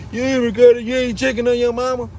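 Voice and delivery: mama calls every day begging for money, mocking nagging voice. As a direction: whine it like mom begging on the phone. Mama calling him, begging for money.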